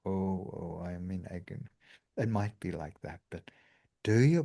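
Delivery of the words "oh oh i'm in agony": hammed-up wallowing in pain, much softer; mock agony. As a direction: let it sink into a theatrical groan of misery. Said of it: "rather than".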